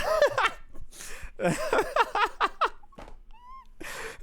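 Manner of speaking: uncontrollable laughter. Real, not acted. Breaks down laughing.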